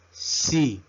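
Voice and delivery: letter C pronounced "see"; English letter C. Shown as a demonstration. Teacher modelling the English alphabet, C as "see". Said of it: "La letra C".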